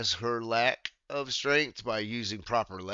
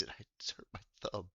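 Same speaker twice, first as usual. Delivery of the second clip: fast breathy whisper, softer; whispered aside; 9 dB quieter, much faster than usual. Real, not acted. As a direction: mumble it under your breath. Quiet aside about the injury.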